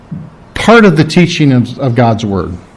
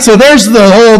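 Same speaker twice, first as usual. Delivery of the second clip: normal talking voice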